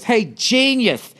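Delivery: high, slow put-on voice of a snide stranger; mocking passerby. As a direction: imitate the dressed-up guy sneering as he storms past. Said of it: "and he goes".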